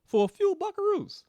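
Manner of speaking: a high mocking echo of the phrase; mocking squeak. Real, not acted. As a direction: squeak it out in scornful mockery. Repeats phrase, second mocking.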